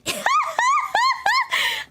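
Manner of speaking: high, loud shriek of laughter. Real, not acted. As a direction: let a wild cackle burst out. Laughing at the haunting memory.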